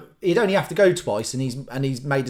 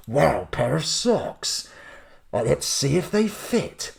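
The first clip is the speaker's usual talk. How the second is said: slow trailer-announcer voice on everyday chat; trailer voiceover guy; 3 dB quieter, slower than usual. Acted. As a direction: make opening socks sound like a film trailer. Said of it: "He just sounds like the guy doing the trailers".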